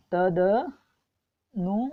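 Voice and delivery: तदनुकूल very slowly, "tada... nu... kool"; syllable by syllable. Shown as a demonstration. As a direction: break तदनुकूल up with long pauses. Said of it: "ये होता है तदनुकूल". (तदनुकूल बहुत धीरे, टुकड़ों में)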